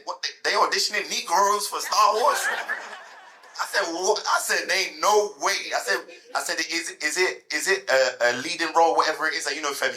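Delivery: shocked he can't believe it; excited disbelieving outburst; stunned disbelief. Retold or imitated. Stunned reaction to Star Wars news.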